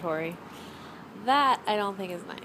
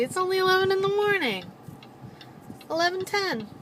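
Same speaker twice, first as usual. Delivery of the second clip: talking to the dog; 10 semitones higher, faster than usual. Real, not acted. Teasing the dog.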